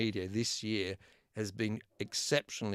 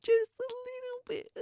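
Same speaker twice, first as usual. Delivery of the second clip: much higher, in a joking falsetto; silly squeaky voice. Pretend. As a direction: pipe it up high like a cheeky cartoon. Teasing joke about Susie.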